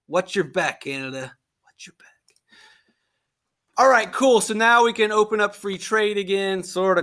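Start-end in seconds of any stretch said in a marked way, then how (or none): 0.1-2.1 softer, fading to a whisper; teacher jokingly warning Canada, whispered; mock menacing whisper. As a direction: threaten Canada in a playful hush.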